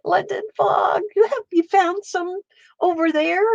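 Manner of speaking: high, delighted call to a friend; excited. Real, not acted. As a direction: gush with surprise and joy. Excited greeting to visitor.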